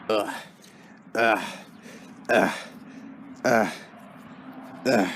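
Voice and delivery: short effortful grunts; Super hauling himself up the ladder, grunting; climbing grunts. Acted. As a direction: strain on each step up. Climbing up to the tree fort.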